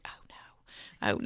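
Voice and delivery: breathy whispered oh no; whispered dismay. Retold or imitated. Telling the host how she reacted, whispered dismay. Dismayed aside at the church call.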